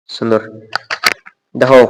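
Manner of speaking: usual speaking voice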